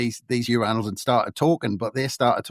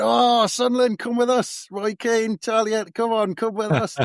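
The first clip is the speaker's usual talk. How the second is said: much higher-pitched, acted delighted greeting; excited welcome; 12 semitones higher than usual. Local lads welcoming a Sunderland fan, excited. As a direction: imitate thrilled locals hauling in a new mate.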